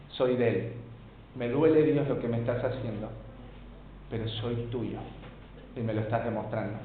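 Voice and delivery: low, subdued believer talking to God; soft, humble confession; submissive prayer. Acted. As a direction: quietly accept the pain, lightly acted. Voices a hurting believer praying to God.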